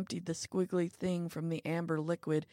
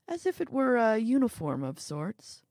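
usual speaking voice

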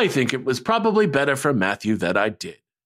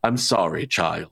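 Santa voice; 12 semitones lower, 5 dB quieter than usual. Acted. Voicing Santa's reply.

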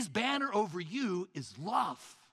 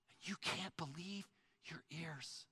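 whisper; 12 dB quieter than usual. Acted. Awed moment at the banquet.

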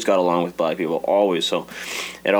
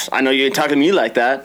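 sassy teeth-sucking; 4 semitones higher, 3 dB louder than usual. Retold or imitated. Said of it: "kiss her teeth a lot at you".

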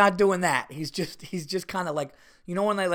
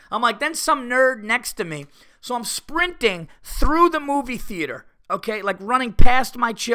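mock rant; 7 semitones higher, 7 dB louder than usual. Ranting about Star Wars nerds.

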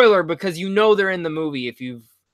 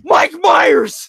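high excited shout of the name; excited yell; 6 dB louder than usual. Retold or imitated. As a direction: yell out in recognition. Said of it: "I literally yelled at the theater".

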